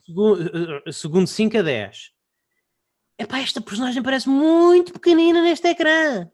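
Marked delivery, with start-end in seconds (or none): 3.2-6.3 inner voice. Pretend. Voicing thoughts from trailer.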